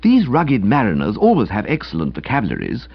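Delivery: ordinary delivery, nothing marked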